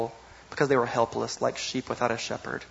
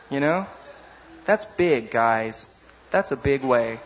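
normal talking voice

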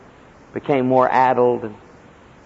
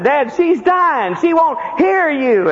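urgent son on phone; 3 dB louder, slower than usual. Retold or imitated. Son tells father on the phone.

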